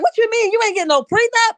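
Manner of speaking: high, loud shocked question; shocked disbelief. Retold or imitated. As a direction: react in disbelief. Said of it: "Was like".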